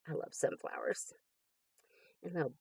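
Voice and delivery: breathy hushed whisper of admiration; soft awed whisper. Real, not acted. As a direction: whisper it as if in awe. Admiring stickers softly.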